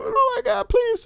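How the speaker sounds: high mock-scared pleading; hammed-up panic before the jump. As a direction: squeak it out, pretend-terrified. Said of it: "She's like".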